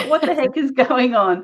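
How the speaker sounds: puzzled and fed up; exasperated. Retold or imitated. Corrected retold exclamation.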